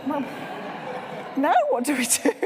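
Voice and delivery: amused panic at being stuck; laughing bewilderment. Real, not acted. Telling trainees how she got stuck, laughing.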